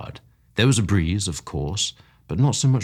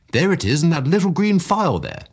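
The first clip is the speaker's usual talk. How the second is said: excited; 11 semitones higher, 5 dB louder than usual. A narrator's reading. Gaburni presents his potion.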